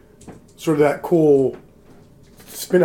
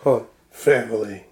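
Vin Diesel; 5 semitones lower than usual. Retold or imitated. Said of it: "The more gravelly my voice gets, the better I do the, uh, unintentional, uh, Vin Diesel".

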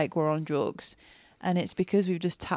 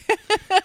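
loud high burst of laughter; real howling laugh; 9 dB louder than usual. Real, not acted. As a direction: let out a genuine howl of laughter. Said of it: "howling, laughing".

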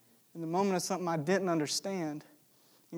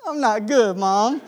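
put-on louder, tearful kid voice; choked-up kid; 4 semitones higher, 11 dB louder, slower than usual. Boy telling his mom he's not good, tearful and loud. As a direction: voice your younger self about to cry. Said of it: "I'm just like".

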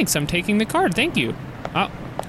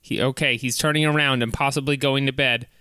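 normal talking voice

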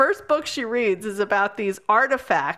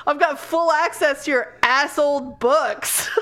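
ordinary talk

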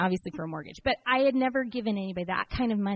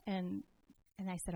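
normal talking voice

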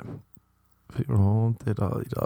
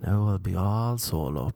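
ordinary delivery

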